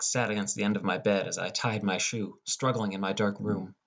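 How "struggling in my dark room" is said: ordinary delivery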